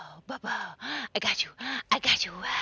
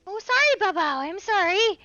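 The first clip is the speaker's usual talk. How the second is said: high pet talk; 11 semitones higher, 7 dB louder than usual. Real, not acted. Apologizing to in-game pet.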